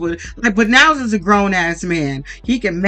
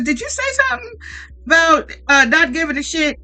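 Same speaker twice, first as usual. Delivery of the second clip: mock host grilling; 7 semitones higher than usual. Host grilling a cast member.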